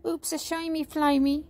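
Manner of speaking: high, sheepish dragon voice; embarrassed squeak. A narrator's reading. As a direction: squeak it like a guilty little creature. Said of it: "squeaked Sparky in embarrassment".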